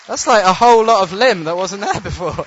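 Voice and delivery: high, loud, laughing amazement; overflowing with joy at the miracle; thrilled disbelief. Real, not acted. Marvelling at the healing.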